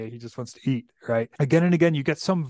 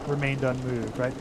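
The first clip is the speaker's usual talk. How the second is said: ordinary talk, nothing marked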